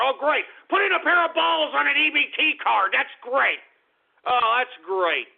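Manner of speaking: fed up and incredulous; exasperated sarcasm. Real, not acted. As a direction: raise the pitch in exasperated mock approval. Sarcastic outrage at a username.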